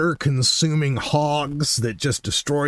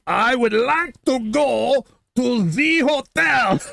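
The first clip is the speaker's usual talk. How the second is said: much higher, slow, mock-shouted word by word; slow emphatic repeat; 10 semitones higher, slower than usual. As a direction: imitate a tourist spelling it out for a foreigner. Repeats it for uncomprehending cab driver.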